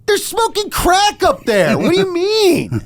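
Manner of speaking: high, loud, slow outburst with a laugh; exasperated shout. Retold or imitated. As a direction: yell it at the cop in disbelief. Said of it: "And I said".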